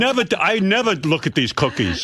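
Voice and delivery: mock stern father laying down the law; imitating father. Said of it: "He goes".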